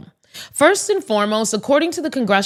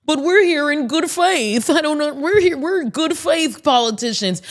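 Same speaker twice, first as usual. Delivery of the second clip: higher, naive voice mocking Democrats; mock naive politician; 5 semitones higher than usual. Mocking Democrats who still trust Republicans, in a naive voice. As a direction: play it wide-eyed and earnest. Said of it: "And Democrats are like".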